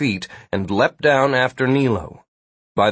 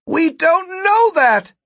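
high, slow emphatic shout; 4 dB louder, slower than usual. A narrator's reading. Gwen challenges Ida's logic, shouted. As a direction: yell it as an angry objection. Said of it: "Gwen shouted".

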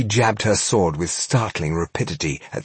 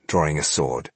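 plain reading voice

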